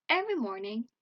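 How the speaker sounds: ordinary delivery, nothing marked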